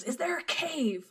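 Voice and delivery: high, drawn-out mock yell; excited retold yell. Prince hearing the scout's report, mock excited yell. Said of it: "the prince yells".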